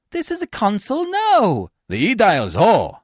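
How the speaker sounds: high, loud summons; indignant call. A narrator's reading. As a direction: call urgently for the officers. Tribune calls for the officers.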